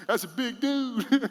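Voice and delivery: laughing it off. Real, not acted. Preacher laughing at a friend's size. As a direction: chuckle through the words.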